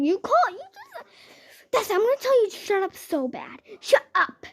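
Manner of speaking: high, loud acted telling-off; angry outburst. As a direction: yell them down in a high voice. Acted shouting match, telling them off.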